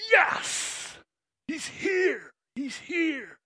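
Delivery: high, breathless cry of joy; excited crowd. Acted. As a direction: cheer like the crowd seeing him arrive.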